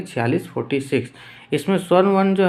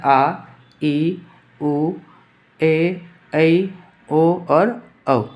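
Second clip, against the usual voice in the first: slow vowel listing; much slower than usual. Shown as a demonstration. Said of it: "teacher listing vowels".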